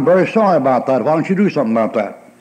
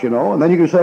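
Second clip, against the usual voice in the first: ordinary talk, nothing marked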